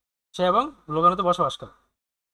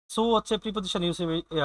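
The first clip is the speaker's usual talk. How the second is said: ordinary talk, nothing marked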